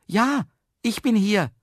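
high-pitched answering call. A narrator's reading. Trapped man answers the speaker, high eager call. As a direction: call back so you are found. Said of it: "rief Herr Jaromir".

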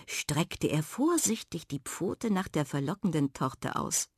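ordinary delivery, nothing marked

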